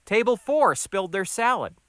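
harried new waiter; flustered waiter. Acted. Flustered waiter reports mishap.

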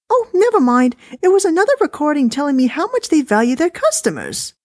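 dismissive, high and chatty; wife voice. Acted. Wife complaining to husband about the recording, high-pitched.

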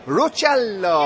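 slow, drawn-out Italian flourish; Italian accent. Shown as a demonstration. Producer showing how to say his drink's name, Italian accent. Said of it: "say it with an Italian accent".

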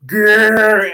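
a playful beastly grrr; mock growl. McAfee about to finish the vodka, mock growl. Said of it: "he'd be like".

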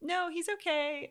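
high, light reassuring nurse voice; breezy nurse. Retold or imitated. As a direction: brush off the worry lightly.

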